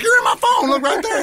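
mock indignant retort. Telling the friend who teased him, in a high pitch. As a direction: snap back up high in pitch.

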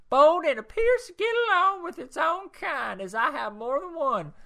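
rereading the review in a high, loud put-on lady's voice; high woman's voice.

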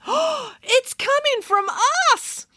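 high, slow horrified piglet; dawning horror in a squeaky voice. A narrator's reading. Piglet realises it's them.

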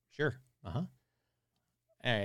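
ordinary talk, nothing marked

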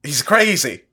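high, loud laughing disbelief. Real, not acted. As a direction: laugh through it, incredulous.

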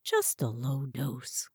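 soft, slow, low aside; low murmur. A narrator's reading. Officer murmuring to partner, soft and slow.

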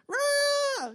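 high-pitched imitated child's wail; mock kid's tantrum scream. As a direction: shriek like a kid going crazy. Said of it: "The kid is going like crazy".

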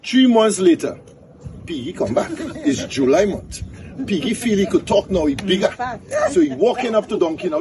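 high-pitched, animated storyteller; folk-tale storytelling. Acted. Tells a donkey folk tale.

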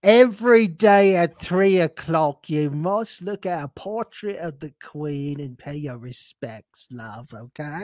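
mock British etiquette lecture; mock British accent. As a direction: lecture like a pompous Londoner.

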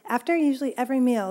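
ordinary talk, nothing marked

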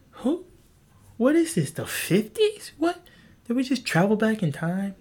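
high, squeaky outraged disbelief; incredulous disbelief. Real, not acted. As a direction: act shocked the slur is that dated.

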